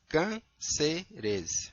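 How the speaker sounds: Cânceres split slowly into syllables. Shown as a demonstration. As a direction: spell out Cânceres syllable by syllable, cân-ce-res. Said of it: "Vamos separar cânceres".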